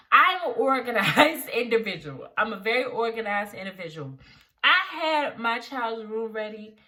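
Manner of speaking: ordinary delivery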